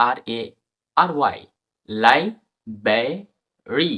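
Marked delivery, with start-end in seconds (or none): 1.9-3.9 লাইব্রেরি stretched out very slowly as "laiberi"; slow pronunciation demo. Shown as a demonstration. Teacher slowly modelling লাইব্রেরি for students. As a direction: draw out লাইব্রেরি slowly, r almost silent. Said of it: "বলি আমরা".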